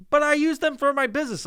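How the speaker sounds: high whiny mock mom voice; mom's excuse. Mom defending her hoarded junk, high whiny mock voice. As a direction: whine it like a defensive mother. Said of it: "And she's like".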